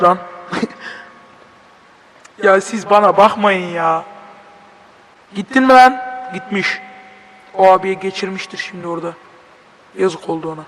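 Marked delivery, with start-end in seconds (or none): none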